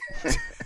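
squeaky high laugh; quick high giggle. Real, not acted. Laughs at own joke.

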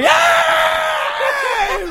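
very high, loud squealed "Yay"; mock celebration cheer. Playing happy people at the pride parade, mock celebration cheer.

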